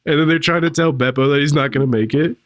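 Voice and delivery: ordinary talk, nothing marked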